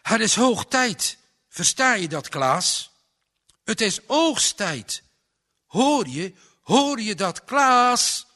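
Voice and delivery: a father yelling his son awake; boze vader roept. A narrator's reading. Father shouting at son in bed. (vader schreeuwt zijn zoon wakker, luid en boos)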